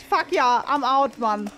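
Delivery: usual speaking voice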